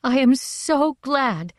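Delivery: high, drawn-out gush of gladness; excited joy. A narrator's reading. Mother greeting her family with news of her dream, excitedly glad. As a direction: gush it slowly, pitched well up. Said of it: "flushed and beautiful in her excitement".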